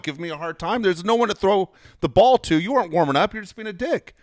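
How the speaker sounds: high, heated retold rant; pissed-off. Reporter challenging the catcher over warming up, pissed off. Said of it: "And I was pissed".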